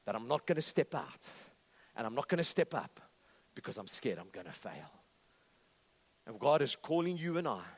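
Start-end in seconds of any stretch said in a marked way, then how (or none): 0.0-4.9 low, subdued voice of defeat; voice of failure. Acted. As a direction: give up quietly, sinking lower at the end. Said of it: "that's the voice of failure".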